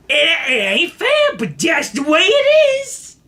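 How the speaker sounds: high, slow, stretched-out mimicry; ranting bus passenger. Drugged man rants to the bus, high drawn-out holler. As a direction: imitate a man wailing his drawn-out rant. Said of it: "he just like".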